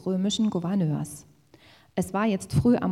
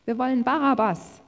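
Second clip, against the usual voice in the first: loud, high call of a crowd; raised-voice clamour; crowd's shouted demand; 5 semitones higher, 7 dB louder than usual. A narrator's reading. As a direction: shout the demand back at the governor. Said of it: "schrien sie zurück".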